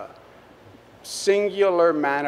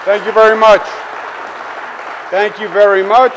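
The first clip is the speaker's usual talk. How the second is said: loud, raised public-address voice; calling out to crowd; 4 semitones higher, 8 dB louder, faster than usual. Real, not acted. Governor thanking a cheering college audience, loud and projected. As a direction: project high and strong over applause. Greeting a crowd over applause.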